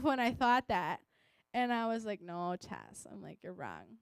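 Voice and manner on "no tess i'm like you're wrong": quiet self-scolding. Retold or imitated. Scolding herself in her head.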